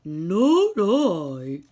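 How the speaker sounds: a sleepy dog grumbling no; sleepy dog refusing. Acted.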